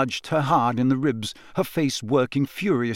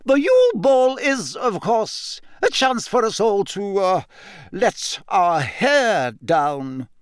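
higher, stern teacher, slowing on the last words; disapproving; 7 semitones higher, slower than usual. A narrator's reading. McGonagall reluctantly tells the class to relax, disapproving. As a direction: say it as though the phrase offends you. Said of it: "she said in a disapproving voice".

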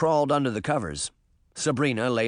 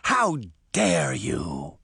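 slow, drawn-out outrage; slow furious exclamation; enraged; 8 semitones lower, much slower than usual. A narrator's reading. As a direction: stretch each word out in indignant rage. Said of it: "Moth shrieked in rage".

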